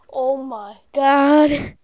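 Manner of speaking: very loud, drawn-out exasperation; exasperated yell. Real, not acted. Player losing patience before threatening to rage quit, exasperated yell. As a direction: stretch it into a frustrated shout.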